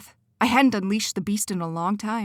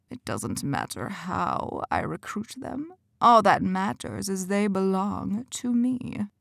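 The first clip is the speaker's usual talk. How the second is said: cold villain; slower than usual. Acted. Quentin dismisses Benny, slow and cold. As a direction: claim them like property.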